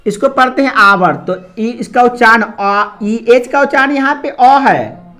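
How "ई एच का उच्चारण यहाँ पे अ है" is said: ordinary delivery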